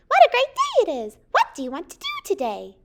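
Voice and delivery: high, loud birdy excitement; happy chirping bird. A narrator's reading. Bird greets Peter on a great day, happy chirping. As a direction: start way up high and chirp brightly. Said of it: "the bird chirped happily".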